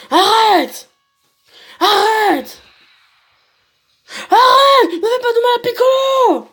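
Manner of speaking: very high, drawn-out shouts; desperate acted cry. Gohan begs enemy not to hurt Piccolo, desperate acted cry. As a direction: beg them to stop, building in force.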